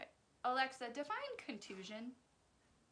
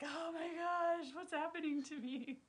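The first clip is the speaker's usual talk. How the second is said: high, drawn-out "what's becoming of me"; mock dismay; 7 semitones higher, 4 dB louder than usual. Vlogger mimics herself baffled by her new politeness to Alexa, mock dismay. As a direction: voice a hammed-up little panic, stretched out.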